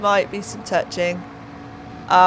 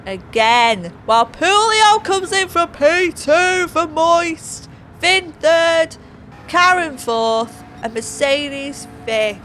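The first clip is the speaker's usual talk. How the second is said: race commentary; 2 semitones higher, 4 dB louder, slower than usual. Acted. Commentator calling the final corner and results, rousing race commentary. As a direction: drag out the placings like a race announcer.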